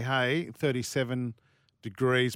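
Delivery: ordinary talk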